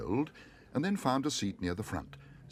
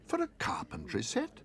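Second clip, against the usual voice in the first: shocked whisper. A narrator's reading. Paddington shocked by price.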